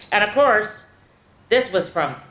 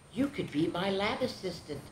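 very soft, hushed offer; nursing Mama; 2 semitones higher, 11 dB quieter than usual. Acted. Mama nursing the little intruder, very soft. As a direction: say it gently, much softer. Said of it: "nursed Mama".

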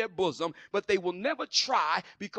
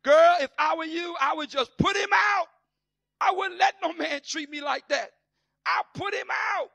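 high, loud sassy urging; indignant friend; 9 semitones higher, 8 dB louder than usual. Retold or imitated. Nosy friend telling a sister to dump her man, loud and sassy. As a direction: egg her on to throw him out.

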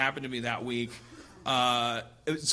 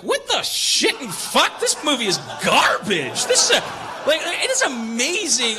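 loud, high, ranting disbelief; outraged delight; 8 semitones higher, 6 dB louder than usual. Retold or imitated. Telling friends how he reacted to Gremlins, a loud high rant. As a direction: rave it like a furious fan. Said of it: "I'm like".

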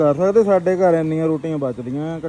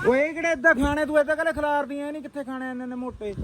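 higher-pitched exasperated scolding; scolding mom; 10 semitones higher, 4 dB quieter than usual. Real, not acted. To mom scattering feed too coarse, higher exasperated scolding. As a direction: tell her off for scattering feed.

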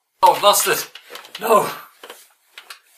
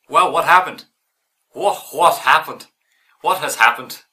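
put-on Irish accent; 7 dB louder than usual. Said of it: "I lost the accent a little bit".

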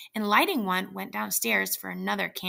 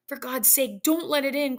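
somewhat higher, urgent and frightened; fearful plea; 5 semitones higher than usual. A narrator's reading. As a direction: beg her to stop. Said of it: "cried the old man, shaking with fear".

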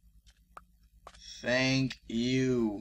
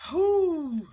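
high relieved whew; 7 dB louder than usual. Real, not acted.

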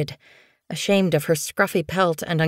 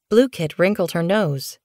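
ordinary delivery

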